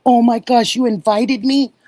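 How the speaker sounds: high, loud delighted surprise; oh my gosh, a real surprise; surprised. Real, not acted. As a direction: gasp it out, stunned and thrilled. Surprised by an invitation.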